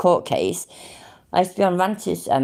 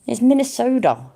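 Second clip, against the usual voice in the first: American accent; 6 semitones higher than usual. Shown as a demonstration. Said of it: "my top American accent".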